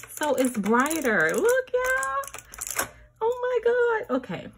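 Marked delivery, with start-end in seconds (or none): 1.4-4.2 much higher, amazed squeal; thrilled. Real, not acted. Showing viewers the brighter lights, high thrilled squeal. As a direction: gasp at how bright it is.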